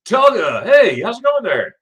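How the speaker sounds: high, loud, bright-eyed hello to a viewer; excited greeting. Real, not acted. Greeting a viewer who just joined the chat, excited and loud. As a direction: call out a big hello across the room.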